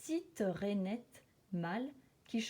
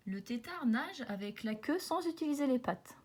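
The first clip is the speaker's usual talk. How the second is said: usual speaking voice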